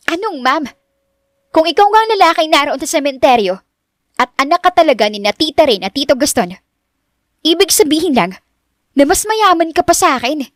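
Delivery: high, incredulous Richelle voice; disbelieving high protest; flustered young woman. Acted. Narrator voices Richelle working it out.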